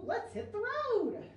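high, loud, slow cheerful call; cheerful truck. Acted. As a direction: sing out like the happy little truck. Truck speaks in story.

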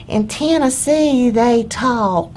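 Tennessee accent, drawn out very slowly and sweetly; slow sweet Tennessee drawl. Shown as a demonstration. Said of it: "That is perfect, actually".

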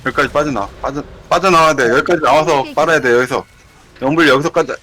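loud urgent raid shout; yelling across the room. Real, not acted. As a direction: yell directions to the party. Raid leader shouting directions.

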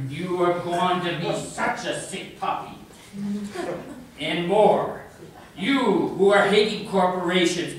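emphatic, chiding declamation; poetry recital. Acted. Poem's voice chiding the sick puppy, emphatic recital. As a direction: lecture the listener in punchy rhythmic verse. Performs poem.

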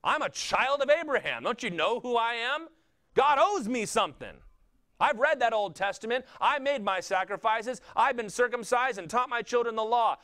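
high, loud affronted protest; indignant. Acted. Child of Abraham objecting to God's mercy, indignant. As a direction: protest as if owed something, pitched well up. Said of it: "They were indignant that their flesh counted".

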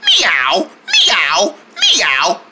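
three falling kitty meows; cat meows. Acted. Cat lover imitating kitties, meowing. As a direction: meow like a little cat.

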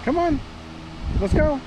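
ordinary talk

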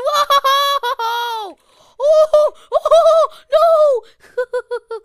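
loud, high yelp of alarm; about to die, yelling; panicked scream. Real, not acted. Getting killed in the game.

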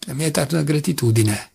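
ordinary delivery

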